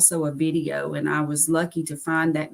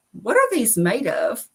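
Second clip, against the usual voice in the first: innocently curious, higher-pitched question; puzzled question; 6 semitones higher than usual. Retold or imitated. Wife asking her ex-husband about pork skins, puzzled and higher. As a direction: ask it like you honestly don't know.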